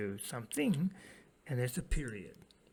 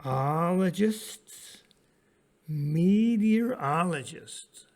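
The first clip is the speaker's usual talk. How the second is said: slow, sounded out; 3 semitones higher, 6 dB louder, much slower than usual. Shown as a demonstration. Teacher to students, sounding out a big word slowly. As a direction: draw out meteorologists syllable by syllable, slow and loud. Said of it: "here's a big word".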